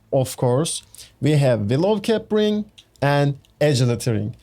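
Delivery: usual speaking voice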